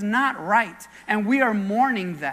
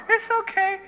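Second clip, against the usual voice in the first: high-pitched imitation of a five-year-old; comforting little girl; 10 semitones higher than usual. Five-year-old girl consoles crying classmate, mock comforting. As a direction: console a friend in a small child's voice. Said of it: "Five years old".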